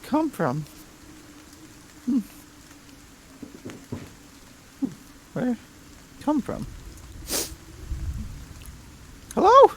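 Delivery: very high, odd little creature; squeaky creature voice. Acted. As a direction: squeak every line way up high. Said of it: "Sounds like a cat".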